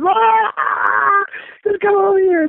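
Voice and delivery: high squealed cry, imitating a friend; very high, drawn-out mock greeting; excited greeting. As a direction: act out a shrieked hello across backstage. Acting out excited backstage greeting.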